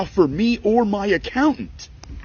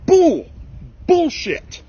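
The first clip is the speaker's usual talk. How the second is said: much higher and louder, exploding; real outraged shout; 11 semitones higher, 4 dB louder, much slower than usual. Real, not acted. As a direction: yell it at the government in disbelief. Ranting at tax agency.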